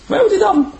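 "Where" jumps up high, loud and pleading; urgent, high-pitched plea; eager high repeat. Acted. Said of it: "repeated louder".

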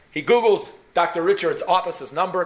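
ordinary delivery

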